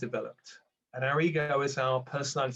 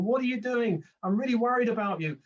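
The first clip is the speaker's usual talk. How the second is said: higher, fretful mock-mother voice; worried mother; 7 semitones higher, 4 dB louder than usual. Mother calling her awakening child, higher and fretful. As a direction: fuss like an anxious mum on the phone. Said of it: "our mother might start calling us up, going".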